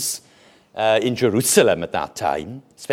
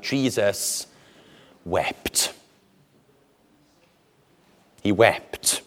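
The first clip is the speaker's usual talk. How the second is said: low, softened, solemn statement; grave, hushed; 5 semitones lower, 5 dB quieter, much slower than usual. Real, not acted. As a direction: let the voice sink gravely. Solemn turn from triumph to weeping.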